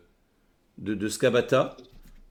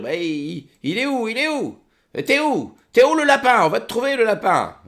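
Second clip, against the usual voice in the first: much higher, louder taunting goblin; 10 semitones higher, 4 dB louder than usual. Acted. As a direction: taunt the hiding prey in a high voice. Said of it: "tu les entends dire".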